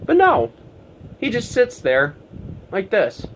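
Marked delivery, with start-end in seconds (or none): none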